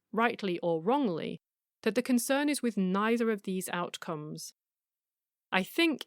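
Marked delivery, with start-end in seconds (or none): none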